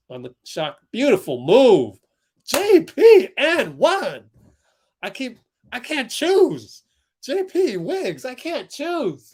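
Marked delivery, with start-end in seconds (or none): 0.9-4.2 loud, building to a high, drawn-out shout; thrilled play reaction, climbing much higher and louder; excited cheer. Real, not acted. Live reaction to a basket. 5.0-9.3 high-pitched, delighted exclamation; thrilled, swooping high voice; excited gushing. Real, not acted. Gushing over two players' plays.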